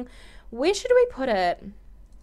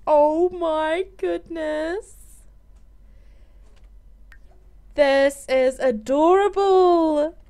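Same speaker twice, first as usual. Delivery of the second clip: slow, higher, choked with happy tears; teary; 5 semitones higher, slower than usual. Real, not acted. Gamer over her Sim's new baby, real happy tears. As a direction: near tears over the newborn. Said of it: "I'm just getting all teary".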